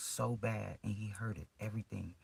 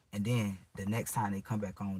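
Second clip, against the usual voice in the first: ordinary delivery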